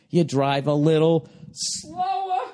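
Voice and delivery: drawn-out slow. Shown as a demonstration. Repeats it, acting out slower.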